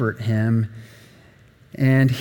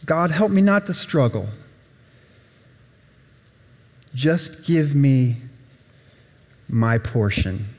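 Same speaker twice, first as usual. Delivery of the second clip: prayer. Acted. Quoted prayer to God.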